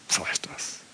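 very soft whispered aside. Real, not acted. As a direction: let it fall to a whisper. Sudden whisper in sermon.

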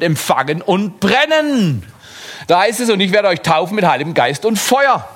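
high, urgent sermon voice; rally them to speak the Word; fiery preaching. Acted. Preacher urging the sick to declare God's Word, fiery and high.

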